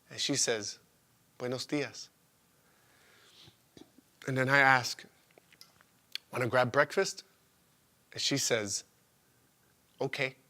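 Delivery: low, soft, intimate narration; tender morning-after storytelling. Acted. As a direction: keep it hushed and unhurried.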